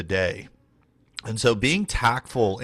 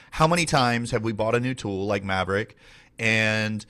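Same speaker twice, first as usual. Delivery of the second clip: usual speaking voice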